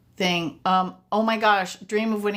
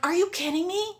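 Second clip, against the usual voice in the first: disbelief; 9 semitones higher than usual. Retold or imitated. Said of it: "I'm like".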